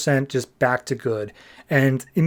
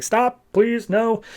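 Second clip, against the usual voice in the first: mock pleading; 8 semitones higher, much slower than usual. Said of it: "crying out for mercy".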